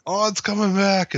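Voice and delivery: high, gleeful mock delight; thrilled at the good news; excited. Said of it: "next week I'll be like".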